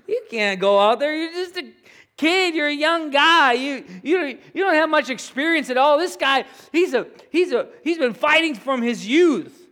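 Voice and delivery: acted protest, pitched high; incredulous objection. Saul telling young David he cannot fight, incredulous. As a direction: talk a youngster out of it, voice pushed up.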